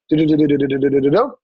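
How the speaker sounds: low, toneless do-do-do babble; imitated chatter. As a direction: rattle off nonsense syllables to stand in for someone talking. Mimics the client's reply chatter.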